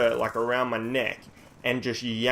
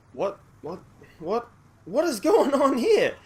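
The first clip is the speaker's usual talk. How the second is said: high-pitched stunned questioning, building louder; bewildered disbelief; 11 semitones higher, 4 dB louder than usual. Retold or imitated. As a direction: squeak it out in mounting alarm, slowing at the end. Retells shock at armed policeman.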